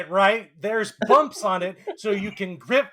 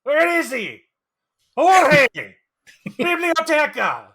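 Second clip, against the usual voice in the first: a hint of Scottish, high and loud teacher; Scottish accent; 4 semitones higher, 4 dB louder than usual. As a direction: bark Spanish lessons in Scottish brogue. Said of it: "an impression of a Scottish Spanish teacher".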